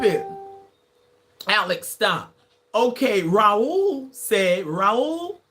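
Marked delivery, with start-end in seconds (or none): none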